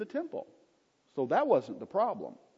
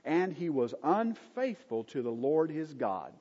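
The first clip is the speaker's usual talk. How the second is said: normal talking voice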